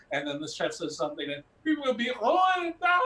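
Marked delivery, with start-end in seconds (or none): 1.6-3.0 high, loud, hammed-up French sob; mock crying wail. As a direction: wail in comic despair, breaking into a cry. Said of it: "We're crying on each other".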